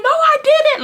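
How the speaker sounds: high-pitched protesting denial; arguing back. Retold or imitated. Teen denying to mom that they sneaked out, arguing back. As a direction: re-enact an indignant denial, pitch shooting up. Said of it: "going back and forth, like, arguing".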